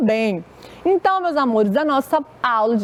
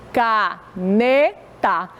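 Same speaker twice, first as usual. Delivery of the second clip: slow "Caneta" as ca, ne, ta with gaps; syllable by syllable; much slower than usual. Shown as a demonstration. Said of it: "Quantas vezes eu fiz isso?".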